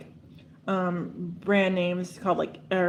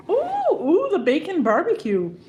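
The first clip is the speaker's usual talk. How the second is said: excited; 9 semitones higher than usual. Real, not acted. First taste of spicy sauce.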